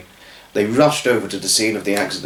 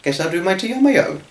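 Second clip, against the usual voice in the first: patient; 7 semitones higher, faster than usual. Acted. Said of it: "he said patiently".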